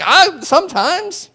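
high, slow sheepish admission; owning up to his wife, sheepishly. Retold or imitated. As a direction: admit it a bit embarrassed. Sheepish reply to Chris.

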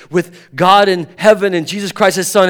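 ordinary talk, nothing marked

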